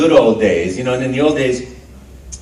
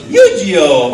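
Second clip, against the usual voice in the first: loud, hammed-up guess at a cartoon voice; made-up anime voice; 5 semitones higher, 7 dB louder than usual. Guessing an unfamiliar anime character for fans, hammed-up. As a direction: belt out the title as an invented anime character. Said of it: "I'm sure that's probably not his voice".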